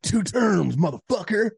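bus driver's yell, high and loud; retold scream. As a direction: shout it like an irate veteran. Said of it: "he was, like, screaming at me".

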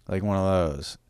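usual speaking voice

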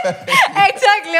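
laughing. Real, not acted. Laughs at retold flirt.

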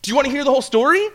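high, fast, excited offer; imitating someone bursting to tell; eager offer. Retold man eagerly offers the story.

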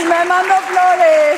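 loud, high, drawn-out joke boast; mock boasting. Woman joking about her invented boyfriend, mock boasting. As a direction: play it up loudly, stretching the words high.

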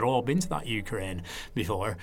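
put-on old Lancashire crew man, offhand and casual; Lancashire accent. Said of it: "So it's my Lancashire accent there".

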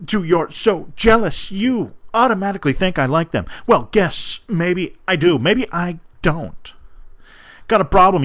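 hammed-up angry breakup letter read aloud; dramatic reading. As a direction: read it like a furious teen dumping her boyfriend. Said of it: "there have been dramatic readings".

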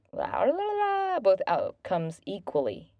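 high sustained la-la-la after a flub; tongue-tied babble. Real, not acted. Speaker stumbles over "both outcomes", babbling reset. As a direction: shake out the tongue on high la's. Garbled word, clears tongue mid-sentence.

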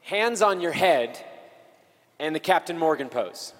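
usual speaking voice

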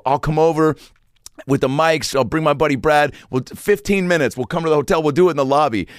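high, insistent retold pitch; eager pleading. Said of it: "And I go".

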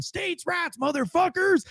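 high-pitched imitation of a man hollering; squawked mock battle cry; mock defiant holler. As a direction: pitch it way up, without real volume. Man yelling while backing the van.

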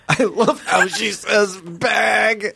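high, drawn-out mock gushing; imitating him. Retelling his odd remark about her accent, high drawn-out imitation. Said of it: "He's like".

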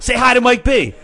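high, loud imitation of a friend; excited shout. Frankie introducing friend to the DJ, excited shout. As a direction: shout it over club music. Friend calling over club noise.

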